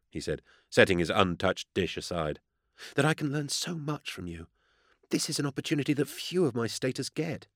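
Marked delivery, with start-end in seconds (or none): 2.9-7.5 high, soft, reverent voice; earnest junior admirer. A narrator's reading. Vervoek grateful to learn from his lord, earnest reverence. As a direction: confide your devotion to a mentor.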